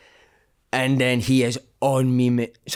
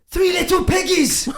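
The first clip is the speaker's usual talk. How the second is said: put-on high, slow, shouted Italian-accented manager; Paolo Di Canio; 3 dB louder, slower than usual. Italian manager mocking his fat players, loud Italian accent. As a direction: bellow it like a shocked Italian boss. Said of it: "he goes like that".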